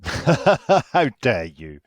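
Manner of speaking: loud, slow, laughing mock indignation; mock outrage. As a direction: play the wounded fan, hamming it up with a laugh. Mock outrage at host's jab.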